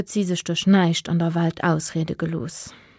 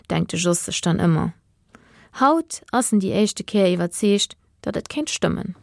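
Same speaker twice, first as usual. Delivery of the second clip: plain reading voice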